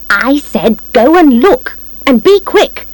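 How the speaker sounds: high, insistent, slow command; impatient order. A narrator's reading. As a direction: order him about, pitch climbing. Wife impatiently orders reluctant husband about.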